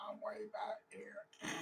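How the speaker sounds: slow whispery radio voice; faint, stretched-out whisper as if from far off; distant walkie-talkie voice. Retold or imitated. Lost friend over walkie-talkie, faint slow whisper. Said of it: "you hear way in the walkie-talkie".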